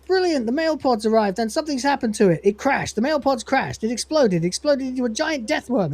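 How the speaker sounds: mock the wild guesses; sarcastic Lister. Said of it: "realise he means male".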